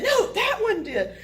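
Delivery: high, slow, loud correction; single out the culprit indignantly; acted huffy finger-pointing voice; emphatic accusation.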